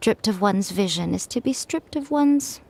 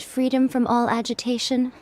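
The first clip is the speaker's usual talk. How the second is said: ordinary talk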